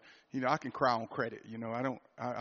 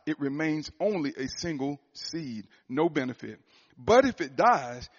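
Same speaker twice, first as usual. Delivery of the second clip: preaching scripture; 2 semitones higher, 6 dB louder than usual. Acted. Pastor rereading John to the church, forceful preaching. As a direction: preach the verse with full conviction.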